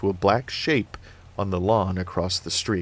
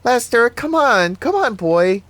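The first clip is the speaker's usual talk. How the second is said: put-on high, louder little girl calling the dog; lightly voiced little girl; 14 semitones higher, 6 dB louder than usual. A narrator's reading. Little girl calls the dog off Annie. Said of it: "a little girl's voice".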